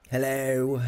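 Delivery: usual speaking voice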